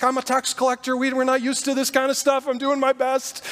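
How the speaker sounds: flustered Matthew. Retold or imitated. Matthew defends himself in panic.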